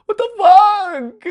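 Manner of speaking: very high, loud screamed curse; terrified scream. Retold or imitated. At the monster following him out, a high terrified shriek. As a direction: shriek it as the monster comes at you.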